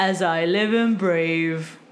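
slow hammed-up Cockney "brave"; bad Cockney impression. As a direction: mimic a terrible Cockney accent, slowly. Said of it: "he's like".

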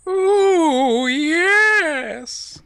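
very high, loud, long-drawn wail; Paul Bearer. Shown as a demonstration. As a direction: let it soar into an eerie howl. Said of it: "give me the "Oh yes".

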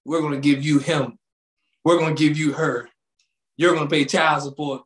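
put-on low, deadpan official handing out kids; mock official. Host imitating lawmakers assigning kids, low deadpan. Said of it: "be like".

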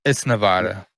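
"Nevada" with the middle vowel as in "bat"; "Nevada" said ne-VAD-a; local pronunciation. Shown as a demonstration. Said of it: "how do you say that".

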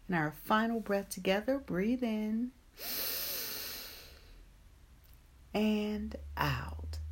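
guided meditation. Acted. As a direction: ease them gently into calm.

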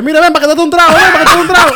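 calling out. Retold or imitated. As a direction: call a passer-by over for a drink. Voicing a host offering drinks.

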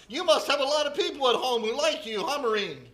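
loud, high barking drill instructor; barking drill sergeant. Retold or imitated. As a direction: bark it at the recruit. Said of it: "barked out".

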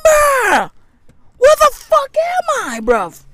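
high, frantic panic; a high, frantic freak-out. Acted. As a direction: squeal it like you are losing your mind. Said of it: "I'm literally freaking out".

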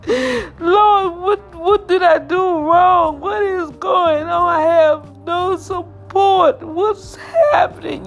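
high, loud, sobbing wail; crying out to God. Retold or imitated. Testifier reliving her tearful plea to God, wailing high. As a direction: cry out in despair, voice breaking. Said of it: "I'm crying".

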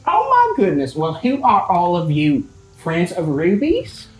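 excited high opening, then eager questions; acted gushing hostess; welcoming mother. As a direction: play a mom delighted by unexpected guests.

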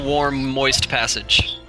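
usual speaking voice

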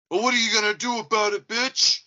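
high, loud mock challenge; confrontational taunt. Delivery driver squaring up to the other driver, high taunting yell. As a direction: imitate a driver yelling a dare.

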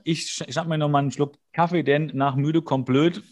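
usual speaking voice

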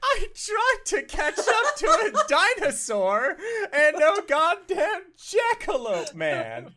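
words broken by laughter; laughing. Real, not acted. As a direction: laugh through the whole line. Player laughing through the line.

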